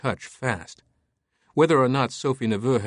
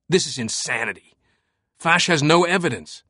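louder, outraged denial; 5 semitones higher, 3 dB louder than usual. Acted. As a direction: insist there is no evidence. Langdon outraged.

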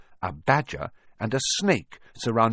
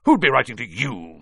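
loud, scornful question, pitched up; sneering; 4 semitones higher, 6 dB louder than usual. A narrator's reading. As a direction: sneer it at a despised nephew. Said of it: "sneered Uncle Vernon".

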